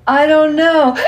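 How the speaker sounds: put-on high, loud child's cry of not knowing; hiccuping little girl. A narrator's reading. Little girl answering her tickling father's joke, high and hiccuping. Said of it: "she answered, hiccuping".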